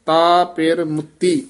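ਮੁੱਤੀ slow, with a firm doubled t; teacher models ਮੁੱਤੀ said tight and slow; tight pronunciation. Shown as a demonstration. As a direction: press the ਤ in ਮੁੱਤੀ, slowly. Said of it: "ਮੁੱਤੀ ਵੀ ਕੱਸ ਕੇ".

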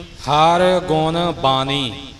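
very slow, ਬਾਣੀ with long aa and single n; slow teacher's model, open vowel in ਬਾਣੀ; correct pronunciation. Shown as a demonstration. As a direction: spell out ਬਾਣੀ slowly as "baa-nee". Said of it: "ਬਾਣੀ ਨਹੀਂ ਬੋਲਣਾ".

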